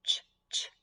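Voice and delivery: whispered ch- twice; first sound. Shown as a demonstration. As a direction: breathe the opening ch sound. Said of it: "first sound".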